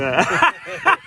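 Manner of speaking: a real laugh breaking through; laughing yeah. Real, not acted. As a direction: crack up on it. Cracking up at joke.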